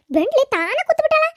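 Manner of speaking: sharp complaint about the seat; indignant grumble. Acted. Annoyed woman on bus seat.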